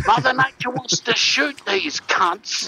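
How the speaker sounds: croc-hunter voice. Retold or imitated. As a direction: gush over the creature like Steve Irwin.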